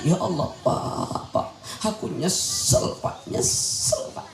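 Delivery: slow, soft sobs breaking up the words; mock sobbing. Mother sobbing regretfully to her husband, mock crying. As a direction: act a weeping mother choked with regret. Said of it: "Nangis emak kita".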